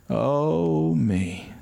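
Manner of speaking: long drawn-out moan, high then sinking; drawn-out, falling groan of a sick man; imitated moan. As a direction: imitate an aged man moaning in pain. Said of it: "the way he would moan characteristically".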